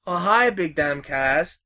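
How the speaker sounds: usual speaking voice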